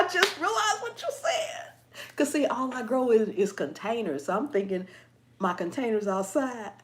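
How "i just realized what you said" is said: high-pitched, laughing realization; amused realization. Real, not acted. Host finally gets a viewer's joke, laughing. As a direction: say it cracking up as the joke lands.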